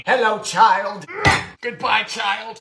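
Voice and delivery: slow, eerie greeting to the pie; creepy greeting. Acted. Host greeting the pie, slow and eerie.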